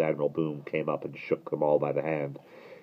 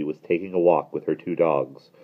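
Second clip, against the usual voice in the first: usual speaking voice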